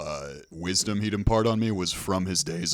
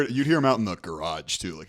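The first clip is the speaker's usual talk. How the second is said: usual speaking voice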